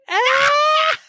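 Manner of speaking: very high mock scream; imitated shriek. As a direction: shriek like she did in the scene. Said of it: "and she was like".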